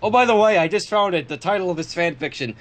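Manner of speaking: ordinary talk, nothing marked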